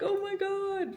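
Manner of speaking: high, drawn-out mock squeal; retold squeal. Reacting to being a favorite YouTuber, retold high squeal. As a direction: re-enact being overwhelmed, way up high.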